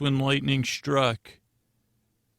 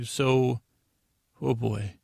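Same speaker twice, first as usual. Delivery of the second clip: usual speaking voice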